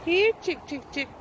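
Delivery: high sing-song chicken call. Real, not acted. Calling chickens at farm.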